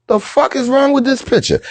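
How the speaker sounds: high-pitched mock disbelief; appalled reaction. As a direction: imitate her disgusted double take in a high voice. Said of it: "her whole grill went from".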